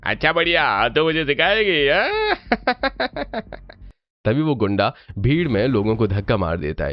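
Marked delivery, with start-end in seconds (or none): 0.0-2.4 higher, sneering thug rising to a taunting हाँ; mocking goon. Goon mocks the girl. 2.4-3.5 mocking thug cackle, high; villain's laugh. Goon laughs at the girl.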